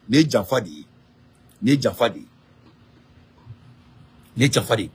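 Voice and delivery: ordinary delivery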